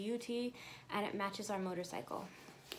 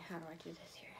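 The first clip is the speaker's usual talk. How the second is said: whisper; 4 semitones lower, 11 dB quieter, much faster than usual. Real, not acted. Fumbling with strap, muttering to self.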